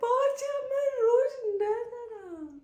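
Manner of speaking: very high, loud whiny half-crying wail; mock wail. Real, not acted. Vlogger wailing to viewers over a makeup flaw, mock crying. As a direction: whine like it's a tragedy.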